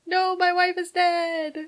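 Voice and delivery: slow, high, hammed-up lament; mock wail. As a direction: wail it out in fake grief. Joking impression of the bereaved husband.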